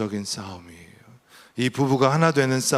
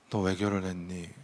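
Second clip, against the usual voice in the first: low, soft divine question; gentle voice of God; 4 semitones lower, 8 dB quieter than usual. Retold or imitated. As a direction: quietly repeat God's searching question. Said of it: "하나님이 푹 얘기하시는 거예요".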